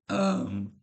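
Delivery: sudden voice crack. Real, not acted. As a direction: voice cracks high on the um. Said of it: "sorry, voice crack".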